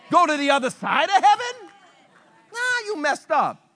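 normal talking voice

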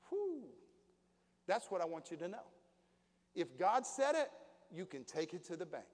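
usual speaking voice

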